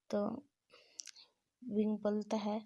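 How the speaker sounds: usual speaking voice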